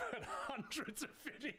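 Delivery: high voice breaking into laughter; laughing. Real, not acted. Creator amused at own hundreds of videos, laughing. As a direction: laugh right through the words.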